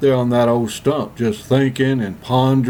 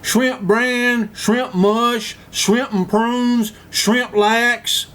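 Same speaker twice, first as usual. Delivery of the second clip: much higher dummy voice, slow on the prunes; Bubba dummy voice; 9 semitones higher, much slower than usual. Retold or imitated. Bubba dummy performing for the old folks, high puppet voice. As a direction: squeak the dummy's list up high and drawn out. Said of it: "screaming out my Bubba puppet show".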